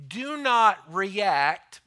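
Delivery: forceful slow warning to the church; loud firm command. Real, not acted. Preacher's strong command.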